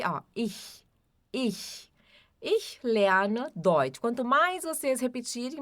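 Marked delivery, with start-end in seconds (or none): 0.3-1.9 ich softer, with a soft ch; German ich. Shown as a demonstration. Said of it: "a pronúncia do ich". 2.4-4.0 Ich lerne Deutsch very slow and careful; teacher models Ich lerne Deutsch slowly; slow pronunciation drill. Shown as a demonstration. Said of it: "a pronúncia do ich".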